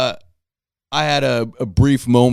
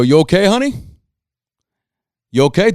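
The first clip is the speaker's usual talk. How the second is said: higher, louder sugary check-in; mock concern; 8 semitones higher, 5 dB louder, faster than usual. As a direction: play innocent and oh-so-concerned, pitched up. Feigned concern to scared driver.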